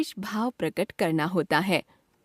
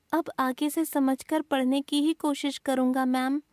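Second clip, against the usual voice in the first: higher, youthful student reply; 4 semitones higher than usual. Acted. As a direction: answer the teacher like a chastened pupil. Student answers teacher.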